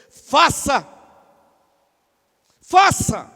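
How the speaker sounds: loud, isolated imperative; emphatic command. Acted. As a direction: order it forcefully, leaving it to ring. Acts Jesus's command to the expert. (ordem enfática em voz alta)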